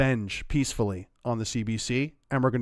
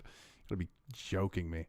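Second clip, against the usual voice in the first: disbelieving whisper, much softer; hushed, stunned whisper; 9 semitones lower, 16 dB quieter than usual. Real, not acted. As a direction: breathe it out in disbelief.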